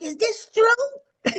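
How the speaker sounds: high, slow and tearful, voice cracking; choked-up high plea; emotional, near tears. Retold or imitated. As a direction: ask it like you're about to cry. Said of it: "I got emotional".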